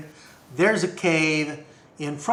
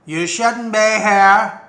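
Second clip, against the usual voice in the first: loud, higher, slow bouncer voice; stern doorman warning them off; 4 semitones higher, 6 dB louder than usual. Acted.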